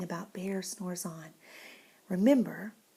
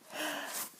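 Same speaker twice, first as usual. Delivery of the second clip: sharp breath before sneezing; sneeze gasp; 6 semitones higher, 9 dB quieter than usual. Acted. Sneeze buildup.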